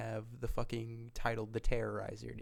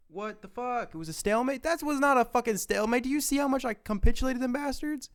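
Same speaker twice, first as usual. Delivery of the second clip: high-pitched indignant squawking; outraged disbelief; 13 semitones higher, 3 dB louder than usual. Real, not acted. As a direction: protest incredulously, voice shot way up high. Outraged at game calling it stalemate.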